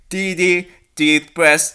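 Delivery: ordinary delivery, nothing marked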